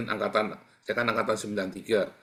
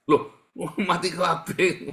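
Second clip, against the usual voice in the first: loud, slow laughing repeat of the bad news; laughing shock; 7 semitones higher, 7 dB louder, slower than usual. Preacher echoing the friend's news in laughing shock. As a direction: echo the news back in loud laughing disbelief.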